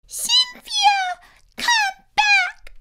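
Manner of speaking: put-on very high, squeaky child's demand; demanding little Emma. A narrator's reading. Emma calling the monster back, high demanding squeak. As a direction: pipe it up like an insistent tot. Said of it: "Emma demanded".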